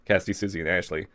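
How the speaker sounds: ordinary delivery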